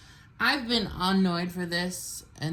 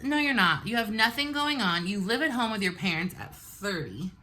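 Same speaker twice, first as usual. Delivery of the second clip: ordinary delivery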